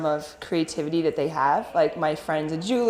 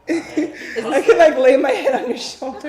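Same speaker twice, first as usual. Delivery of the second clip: laughing; 10 semitones higher, 6 dB louder than usual. Acted. Gushing mock tenderness laughing.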